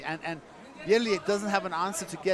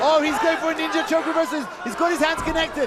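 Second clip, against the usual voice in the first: excited shout; 8 semitones higher, 4 dB louder than usual. Real, not acted. Ninja choke reversal attempt.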